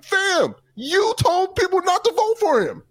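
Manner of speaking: high, exasperated callout; high-pitched you-did-this callout; incredulous callout. Retold or imitated. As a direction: call him out, disbelieving and high. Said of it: "I'm like".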